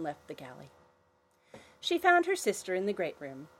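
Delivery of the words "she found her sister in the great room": ordinary delivery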